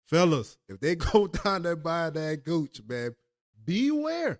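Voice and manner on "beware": high, drawn-out comic warning; mock warning. Real, not acted. Man warning the fellas, comically alarmed.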